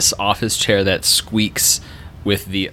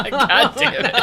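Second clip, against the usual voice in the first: laughing. Real, not acted. Laughing at intro.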